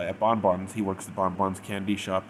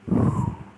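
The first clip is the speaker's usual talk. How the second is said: loud breathy sigh at sadness; heavy sigh; 6 dB louder than usual. Real, not acted. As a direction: let out a big sigh. Reacting to the sad letter.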